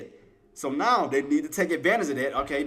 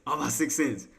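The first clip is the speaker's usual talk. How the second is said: ordinary talk, nothing marked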